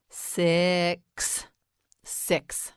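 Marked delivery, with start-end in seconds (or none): 0.1-1.5 "Six" slowly, vowel and ending drawn out; stretched out. Shown as a demonstration. Teacher models the number word, "Six" slowly stretched. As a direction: draw out "Six" sound by sound. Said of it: "Now you say it".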